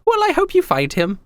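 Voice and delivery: very high, gushing and excited emperor voice; giddy young emperor. Acted. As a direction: squeal each greeting like a flustered young ruler. Same emperor voice.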